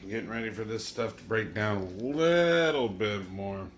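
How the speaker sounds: normal talking voice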